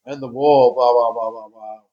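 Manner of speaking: loud, exasperated rattling off of the news; yelling in frustration at the nightly news; angry rant. Real, not acted. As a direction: shout the list out in disgust. Ranting about the news.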